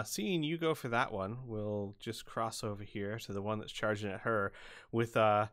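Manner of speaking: ordinary talk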